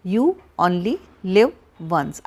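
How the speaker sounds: "you only live once" drawn out slow, each word set apart; slow spaced-out slogan. Shown as a demonstration. Counselor repeating the slogan to viewers, slowly. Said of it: "आजकल कहते हैं ना".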